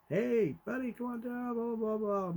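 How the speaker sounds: high, softened sing-out call; mock calling upstairs without real volume, pitch held high; retold yell upstairs. Dad summoning unsuspecting son from upstairs, mock casual yell. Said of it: "I yell upstairs".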